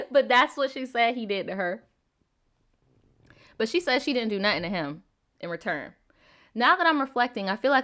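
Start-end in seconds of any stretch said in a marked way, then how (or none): none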